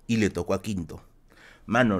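normal talking voice

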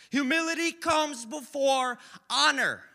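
preacher belting the verse; loud slow proclamation. Shown as a demonstration. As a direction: proclaim it like the verse's second telling. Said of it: "He says it twice".

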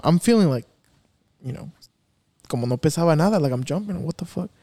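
ordinary delivery

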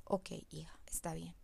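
hushed, gentle whisper to her daughter; soft reply. Retold or imitated. Mother quietly letting her daughter go alone, whispered.

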